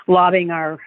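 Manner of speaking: normal talking voice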